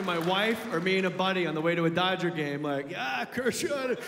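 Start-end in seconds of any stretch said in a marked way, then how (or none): 3.0-4.0 lightly voiced stoner. Stoned buddy in truck.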